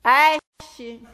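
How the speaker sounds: loud mock yell at the kid; mother shouting her child out of the water. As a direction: bark it like an angry beach mom. Mother yelling from the beach.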